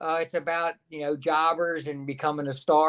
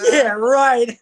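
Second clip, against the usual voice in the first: laughing disbelief; 8 semitones higher, 8 dB louder than usual. Retold or imitated. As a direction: scoff it out through a laugh, higher and louder. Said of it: "I laughed right in Jim Ross's face".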